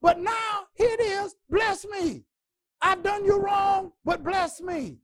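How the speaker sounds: high, loud pleading shout; Pharaoh pleading. Acted. Pharaoh begging Moses to bless him, high pleading shout. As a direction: demand a blessing while admitting wrong. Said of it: "And he says".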